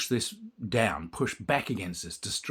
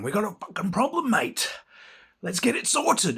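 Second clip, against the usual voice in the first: high, loud mock-tough Aussie bloke; aggressive challenge; 11 semitones higher, 4 dB louder than usual. Speaker confronting the man who yelled a slur, loud and tough. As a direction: square up to the guy and call him out.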